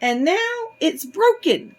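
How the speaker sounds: high, tiny little bear voice; squeaky-high baby bear. A narrator's reading. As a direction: cry it out as a little cub, high-pitched. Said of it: "cried in his tiny little voice".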